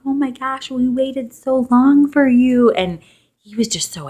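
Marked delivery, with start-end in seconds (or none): 0.0-2.7 high, slow tender voice; tender awe. Retold or imitated. Mother talking to her newborn, high and slow in awe. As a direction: melt over the newborn.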